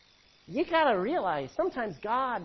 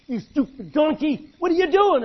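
higher and louder, furious at the donkey; angry yell; 7 semitones higher, 9 dB louder than usual. Acted. Balaam shouting at his stubborn donkey, furious and loud. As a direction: bellow it while beating the animal.

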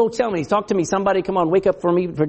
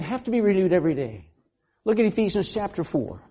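normal talking voice

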